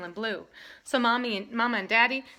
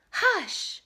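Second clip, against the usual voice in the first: sharp high hush; 10 semitones higher than usual. A narrator's reading. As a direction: shush him firmly. Folks hushing loud baby.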